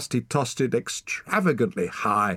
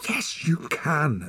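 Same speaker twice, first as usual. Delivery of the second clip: put-on slow, higher urging girl; 8 semitones higher, slower than usual. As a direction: push him on, firm and slow. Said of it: "urged Ren".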